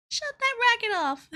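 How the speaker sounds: high squeaky mock scold; squeaky mock clerk. Teasing herself about store staff, squeaky mock scold. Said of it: "Were they like".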